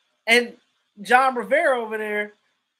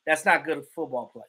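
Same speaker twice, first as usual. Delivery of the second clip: normal talking voice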